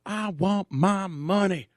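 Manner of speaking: high, furious yelling about stolen money; angry screaming. Retold or imitated. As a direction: rant like a dancer robbed of her cash.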